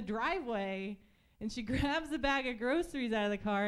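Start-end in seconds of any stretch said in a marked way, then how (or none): none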